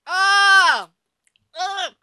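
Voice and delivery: loud high disgusted yelp; disgusted shriek. Real, not acted. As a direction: shriek at the taste, real disgust. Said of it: "I overreacted".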